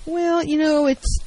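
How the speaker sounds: a meek partner who beats around the bush; passive hedging. Shown as a demonstration. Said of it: "the failure to get to the point is passive".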